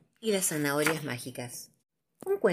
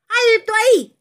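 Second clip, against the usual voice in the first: much higher, loud raspy cry; hoarse cracked voice; 12 dB louder than usual. Acted. Wizard Hilario stopping the dragon, hoarse cracked shout. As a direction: bark a stop from far off. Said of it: "una voz ronca y medio cascada".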